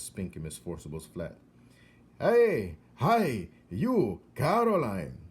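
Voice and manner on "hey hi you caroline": high, loud call over a railing; shouting down. Acted. Old man upstairs calling down to Coraline, loud and high. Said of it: "he shouted over the railing".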